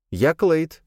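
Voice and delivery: put-on high lisping wild boy. Voices the boy Clade.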